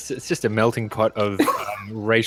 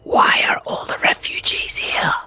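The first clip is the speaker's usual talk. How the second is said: fully whispered, secretive question. Acted. Bracho leans in to Trevor's ear, whispering. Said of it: "like, whispers into Trevor's ear".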